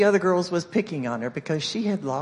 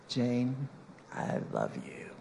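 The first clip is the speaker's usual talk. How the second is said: low, soft and tender; sincere self-affirmation; 5 semitones lower, 9 dB quieter, much slower than usual. Retold or imitated. Speaker to own mirror image, quietly sincere. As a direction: say it quietly and slowly to yourself. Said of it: "look at myself ... and say".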